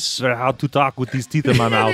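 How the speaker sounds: put-on comic Austrian accent, excusing himself; Arnold. Impressionist blames teeth in his mouth, Austrian accent.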